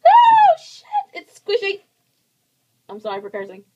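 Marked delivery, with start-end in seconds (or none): 0.0-1.8 very high, loud shriek of surprise; startled shriek. Real, not acted. Touching the squishy lizard, a startled high shriek. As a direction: yelp as it squishes.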